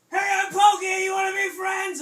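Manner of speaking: very loud, high cartoon shout; full-out high holler; screamed greeting. A narrator's reading. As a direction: yell it so everyone can hear. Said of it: "So he screamed really loud".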